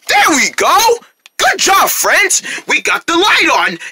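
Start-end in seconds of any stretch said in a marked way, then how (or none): none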